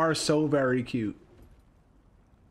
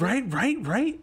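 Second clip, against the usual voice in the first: eager agreement; 8 semitones higher, slower than usual. Real, not acted. Player chimes in while petting dogs.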